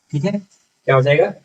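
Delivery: ordinary talk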